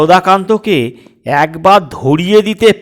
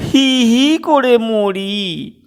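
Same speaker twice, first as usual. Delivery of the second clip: slow drawn-out chattering words; mock shivering; 6 semitones higher, much slower than usual. Girl complaining she shivers in cold, mock shivering. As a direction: drag it out as if teeth chatter.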